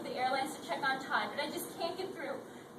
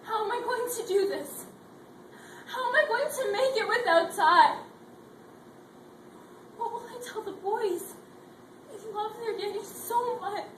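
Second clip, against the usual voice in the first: tearful, raised lament; despairing prayer; 4 semitones higher, 6 dB louder than usual. Acted. Widow alone asking God for strength, tearful and anguished. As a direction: plead with God in grief.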